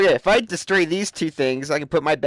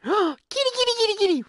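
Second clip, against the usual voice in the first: calling kitty. Real, not acted. Calling a cat in game.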